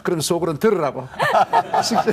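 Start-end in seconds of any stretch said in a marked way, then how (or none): 1.1-1.8 a high, loud burst of laughter. Real, not acted. Pastor laughing about praying so she would hear.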